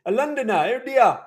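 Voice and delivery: high, loud mock Londoner; put-on London accent. Guessing a viewer is from London, in a mock London accent. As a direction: play up a British accent, pitch rising. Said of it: "My British accent".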